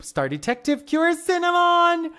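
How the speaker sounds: high loud proclaimed title; excited announcement. Real, not acted. Streamer hypes the new cure's name, excited announcement. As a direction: shout the name like a hero's entrance.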